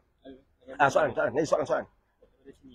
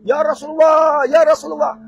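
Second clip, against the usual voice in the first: a louder, higher call for help; desperate cry; 5 semitones higher, 8 dB louder than usual. Acted. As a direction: cry out to the Prophet in despair. Damned calling on the Prophet.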